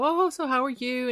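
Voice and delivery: high, bright mock chit-chat opener; gushy chatty greeting. Host mimicking women's chatty phone opener, mock gushy. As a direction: overdo the friendly small talk. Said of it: "I know we always go".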